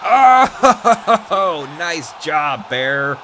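laughing praise; excited cheer. Real, not acted. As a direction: whoop for your partner. Partner makes good move.

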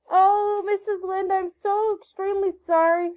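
high, overwrought apology opening on a loud "Oh"; quivering apology. A narrator's reading. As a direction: plead sorry with an acted quiver. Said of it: "with a quiver in her voice".

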